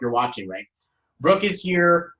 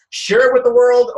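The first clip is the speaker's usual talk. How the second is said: excited burst; 10 semitones higher, 10 dB louder than usual. Real, not acted. Hyping up broadcasting the wedding.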